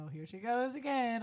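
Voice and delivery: laughing mock exasperation; drummer laughing at her. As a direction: imitate a bandmate's amused ribbing. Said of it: "My drummer will laugh at me".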